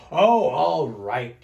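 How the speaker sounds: loud, slow, grudging give-in; big resigned sigh. A narrator's reading. Smelly bear giving in to a bath, heavy reluctant sigh. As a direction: heave it out reluctantly. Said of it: "sighed a big sigh".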